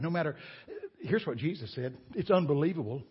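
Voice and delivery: usual speaking voice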